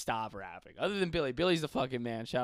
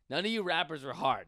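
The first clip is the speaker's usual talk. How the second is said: normal talking voice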